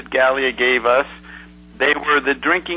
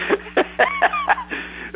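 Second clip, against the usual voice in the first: high laugh; 3 dB quieter than usual. Real, not acted. Laughs after the joke.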